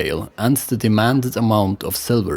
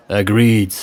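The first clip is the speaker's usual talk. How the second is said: ordinary delivery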